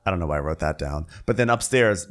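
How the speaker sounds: ordinary talk, nothing marked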